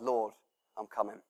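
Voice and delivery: hushed and low, answering the Lord; quietly surrender, voice dropping away; soft reply to God. Pretend. Heart answers God softly.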